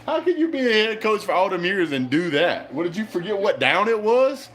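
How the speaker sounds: high, incredulous scolding; exasperated rant. Real, not acted. Fan ranting at head coach's blunder, exasperated. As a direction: berate the coach in disbelief.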